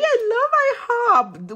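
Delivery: very high, drawn-out squeal; gushing. Real, not acted. Nail tech reacting to talk of ihop, high gushing squeal. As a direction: gush in a slow, sky-high voice. Gushing over a restaurant mentioned.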